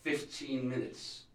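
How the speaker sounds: ordinary delivery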